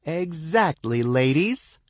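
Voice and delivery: high, loud gloating crow. A narrator's reading. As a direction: crow it triumphantly. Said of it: "T B crows".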